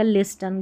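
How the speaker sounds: "लिस्टन" with the t sounded, lis-tun; wrong pronunciation. Shown as a demonstration. Teacher shows the mistake, "लिस्टन" with audible t. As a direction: misread listen as "लिस्टन". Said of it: "गलत हो जाएगा".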